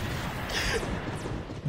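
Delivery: breathless, voiceless laugh; real helpless wheezing laugh. Real, not acted. Laughing mid fight.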